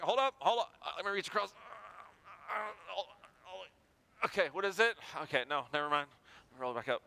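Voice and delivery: strained voice, breath squeezed out while stretching; mock effort cranking a manual window; straining. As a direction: grunt through the reach across the seat.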